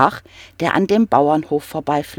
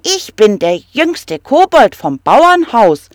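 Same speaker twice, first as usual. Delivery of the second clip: higher, louder, puffed up; proud little kobold; 5 semitones higher, 6 dB louder than usual. Acted. Little kobold introduces himself to the troll, proudly. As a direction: stand tall and announce yourself. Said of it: "sagte der kleine Kobold".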